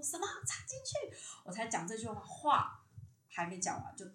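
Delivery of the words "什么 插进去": shocked disbelief. Retold or imitated. Recalling her dream, she repeats the strange order, voice leaping up in surprise. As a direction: pitch jumps way up on the question in retold shock.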